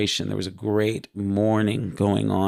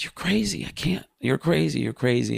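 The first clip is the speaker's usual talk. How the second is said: a hint of shocked Michael, higher; Michael refusing; 7 semitones higher than usual. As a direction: push him away in disbelief. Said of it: "Michael says".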